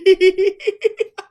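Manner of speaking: loud, high burst of laughter. Real, not acted. Laughs at chatter.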